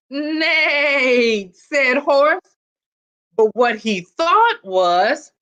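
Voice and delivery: put-on lively read-aloud for children; storybook reading. A narrator's reading. Principal reading a picture book to students, lively storytelling. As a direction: tell it like a picture book to a class.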